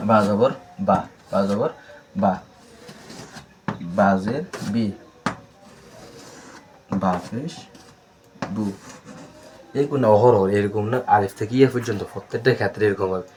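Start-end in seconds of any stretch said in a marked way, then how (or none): 0.1-8.7 বা জবর বা, বা জের বি, বা পেশ বু with long pauses between; teacher models syllables for students to copy; slow spelled-out drill. Shown as a demonstration. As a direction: spell each syllable slowly for repetition. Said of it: "teacher demonstrates syllables slowly".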